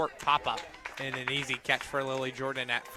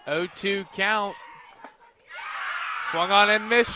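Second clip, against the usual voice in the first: louder, higher play-by-play, building to the strike three; excited strikeout call; 6 semitones higher, 7 dB louder, slower than usual. Real, not acted. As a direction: ride the rising thrill of back-to-back strikeouts.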